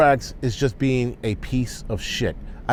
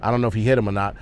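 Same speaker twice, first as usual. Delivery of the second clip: normal talking voice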